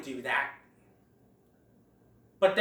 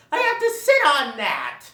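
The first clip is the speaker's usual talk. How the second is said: higher, louder squawking protest; Sid's protest; 8 semitones higher, 7 dB louder than usual. Retold or imitated. Sid protesting after something pokes his butt, squawked. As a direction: yelp it like a poked sloth. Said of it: "Sid ... says".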